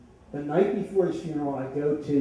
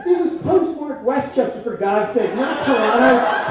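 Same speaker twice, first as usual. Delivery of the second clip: higher, louder, laughing through the confession; 8 semitones higher, 7 dB louder than usual. Real, not acted. The speaker confesses the fake birthday card to the audience, laughing. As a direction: giggle while owning up.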